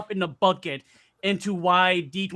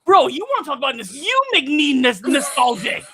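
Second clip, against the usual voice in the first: fast, higher teasing echo; teasing mock; 7 semitones higher, 5 dB louder than usual. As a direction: rib him playfully, quicker and up. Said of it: "And I'm like".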